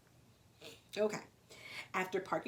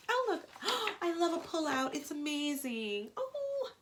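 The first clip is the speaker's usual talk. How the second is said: delighted; 11 semitones higher than usual. Real, not acted. Delighted at the pop-up page.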